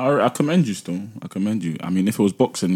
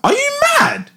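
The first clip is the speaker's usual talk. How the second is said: high, disbelieving squeal; incredulous. Real, not acted. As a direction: react in total disbelief. Incredulous challenge in banter.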